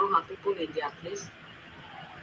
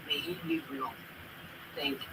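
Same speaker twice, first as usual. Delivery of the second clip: barely audible; 6 semitones lower, 9 dB quieter than usual. Real, not acted. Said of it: "Your, your voice is very low".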